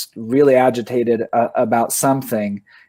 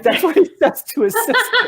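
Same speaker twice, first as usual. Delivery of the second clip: laughing. Real, not acted.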